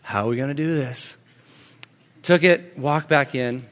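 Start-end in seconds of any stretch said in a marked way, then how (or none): 0.0-1.2 low, soft, weary self-question; retold parent steadying himself; quietly gathering patience; parental sigh. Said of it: "the parental breath".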